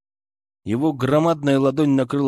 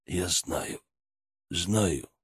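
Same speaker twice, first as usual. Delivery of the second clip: quiet low reassurance; calm her down, low and soft; low soft father voice; 6 semitones lower, 7 dB quieter, much slower than usual. Acted.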